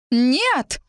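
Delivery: высокий протестующий вскрик свекрови; выкрикни высоко, будто заголосила; истошный вопль. A narrator's reading. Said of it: "дурниной заголосила Элеонора де Рейланд". (заголосить дурниной, но без полного крика)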